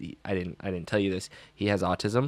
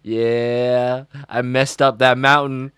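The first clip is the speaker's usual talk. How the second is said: put-on a kid proudly owning his tubing chaos; 3 semitones higher, 7 dB louder, slower than usual. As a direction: brag like it's an achievement. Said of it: "And he goes".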